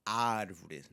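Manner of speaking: árvore with a nice open first A; árvore, long open first syllable. Shown as a demonstration. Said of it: "nice and open sound".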